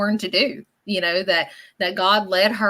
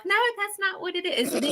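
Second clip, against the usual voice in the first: mock scolding; 11 semitones higher than usual. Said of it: "she got on to me for that".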